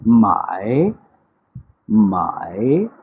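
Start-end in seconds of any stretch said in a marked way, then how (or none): none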